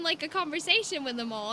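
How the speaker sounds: normal talking voice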